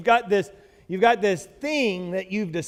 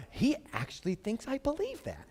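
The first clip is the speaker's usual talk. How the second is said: quiet, mocking aside under the breath; muttered inner thought; 3 semitones higher, 11 dB quieter than usual. Pastor voicing a listener's thought, quiet and mocking.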